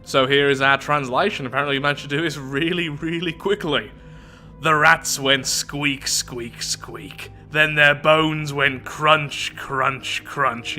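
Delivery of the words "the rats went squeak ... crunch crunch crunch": slow smoker-voiced dragon; dragon voice, middle-aged smoker. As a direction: drag out each word like a smoky old dragon. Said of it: "Yes, this is her voice. Sounds like a middle-aged smoker".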